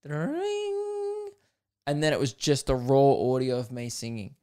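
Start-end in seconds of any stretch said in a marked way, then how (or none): 0.0-1.3 high, held ringing sound; high-pitched vocal "dring" effect; imitated sound effect. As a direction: imitate a jingle's opening effect, high and sustained. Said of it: "sound effect at the start that went like".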